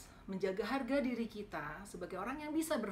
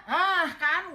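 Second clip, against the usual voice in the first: high, much louder vindicated cry; triumphant told-you-so; 9 semitones higher, 14 dB louder than usual. Retold or imitated. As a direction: crow that you were right. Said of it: "gue bilang".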